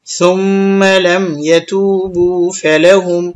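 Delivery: slow tajweed chant with held sounds; Quran recitation. Shown as a demonstration. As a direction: recite slowly, stretching vowels and hums. Recites the phrase just spelled out.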